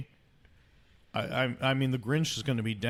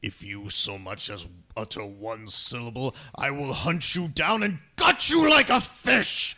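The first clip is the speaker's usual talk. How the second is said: threatening message; 5 dB louder than usual. Retold or imitated. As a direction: threaten the caller then explode. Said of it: "Better check the outgoing".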